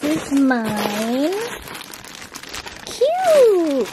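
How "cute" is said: very high, excited squeal; cute squeal. Real, not acted. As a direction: squeal over something adorable. Unboxing cute figurines.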